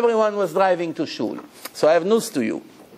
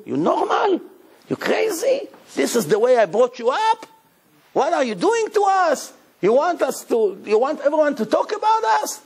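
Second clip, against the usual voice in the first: scolding parent; 9 semitones higher than usual. Retold or imitated. Parent scolds son in room.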